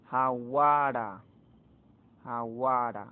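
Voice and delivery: Jaguara slowly, stretching the middle "gua"; stress on strong vowel. Shown as a demonstration. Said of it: "Acá recae sobre la fuerte".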